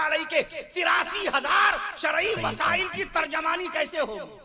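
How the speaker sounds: high, driving rhetorical list; fiery preaching. Real, not acted. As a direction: hammer out each clause, rising to a challenge. Rhetorical list at full force. (پرجوش خطیبانہ للکار، اونچی آواز)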